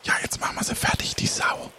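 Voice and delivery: fast hushed whisper, no voice at all; whispering Florian. Acted. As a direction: breathe it out quickly like a sneaky pirate. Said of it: "Der flüsternde Florian".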